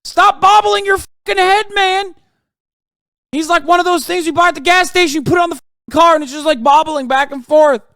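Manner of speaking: high, loud, fed-up shouting at the screen; loud, disgusted rant at a bobblehead; exasperated yelling. Real, not acted. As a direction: yell at him to stop bobbing his head. Yelling at bobbing candidate on screen.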